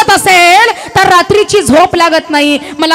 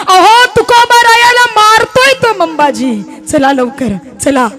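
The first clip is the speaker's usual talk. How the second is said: urgent alarm; 6 semitones higher than usual. Acted. Messenger runs in with bad news.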